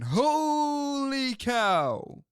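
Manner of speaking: high, very slow stretched call; Harry Caray catchphrase. Retold or imitated. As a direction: draw it out like a home run call. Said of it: "Harry's whole thing was going".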